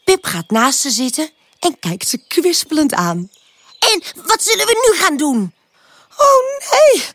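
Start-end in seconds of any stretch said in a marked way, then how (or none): 3.8-5.5 quick, high expectant question; eager. A narrator's reading. Pip to exhausted tulips, wanting more play, eager. 6.2-7.0 very high squeaked dismay; squeaky. A narrator's reading. Tulips dreading another game with Pip, squeaky dismay. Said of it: "piepen de tulpjes".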